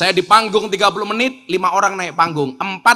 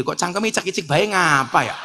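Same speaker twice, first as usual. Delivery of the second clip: ordinary talk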